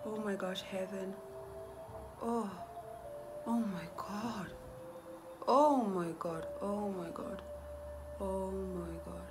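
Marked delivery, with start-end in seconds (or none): none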